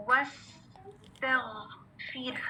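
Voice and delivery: slow, whispered model of वस्तगफिर; whispered slow recitation for students to copy. Shown as a demonstration. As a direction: breathe the word out slowly for the class. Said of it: "दोबारा से सुने".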